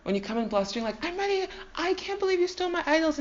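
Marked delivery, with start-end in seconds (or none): 1.0-3.2 high, fast, indignant bluster. Acted. Laban storming in over stolen idols, blustering. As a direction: bluster in, pitched up and rushing. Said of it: "blustering, I mean, this is the way I picture it".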